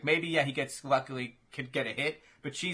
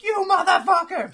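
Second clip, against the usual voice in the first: high, loud hammed-up outburst; play-acted rage, pitched way up; mock furious shout; 10 dB louder than usual. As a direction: yell it like an outraged comic-book fighter. Acted outburst of enraged fighter.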